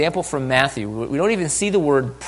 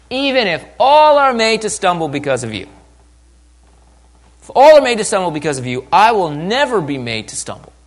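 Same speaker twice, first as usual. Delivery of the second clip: loud, higher, overconfident disciple; boastful Peter; 4 semitones higher, 6 dB louder than usual. Acted. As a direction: proclaim it boldly as a proud vow. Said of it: "here comes Peter".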